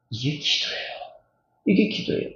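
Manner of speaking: breathy whisper of 이게 기도예요; whispered emphasis. Real, not acted. Pastor whispering to congregation what prayer is, hushed. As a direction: hush it, stressing 이게. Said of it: "repeated softly".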